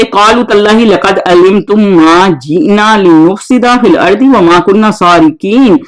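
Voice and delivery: slow, drawn-out Arabic recitation; a teacher reciting the verse before translating it; Quran recitation. Shown as a demonstration. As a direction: chant the verse slowly and evenly.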